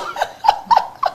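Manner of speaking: breathy, high-pitched laugh. Real, not acted. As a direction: laugh softly at your own story. Amused while telling her story.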